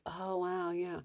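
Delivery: soft, flat acknowledgement; brain dead reply. Shown as a demonstration. Parent deflecting insult softly, flat calm. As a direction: say it like it barely lands. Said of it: "brain dead kind of comments".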